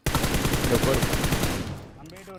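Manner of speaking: breathless whisper, drawn out; whispered wait. Real, not acted. Gamer hushing teammates while hunting the last enemy, whispered. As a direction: hush the team while sneaking.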